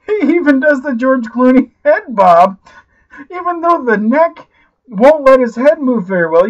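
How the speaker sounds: normal talking voice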